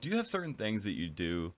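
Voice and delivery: ordinary delivery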